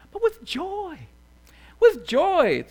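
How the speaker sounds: high, lifted burst of joy; joyful. Shown as a demonstration. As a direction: let the pitch leap with delight. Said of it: "And not somberly like".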